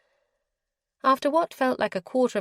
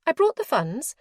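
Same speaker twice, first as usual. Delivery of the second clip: higher, prim lady's voice, businesslike; proper and firm, voiced a bit higher; formal young lady; 6 semitones higher than usual. A narrator's reading.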